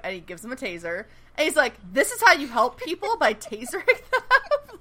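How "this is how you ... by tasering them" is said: incredulous Mohinder. Retold or imitated. Mohinder questions the taser he was handed, incredulous and high. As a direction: stretch out tasering them in mock disbelief.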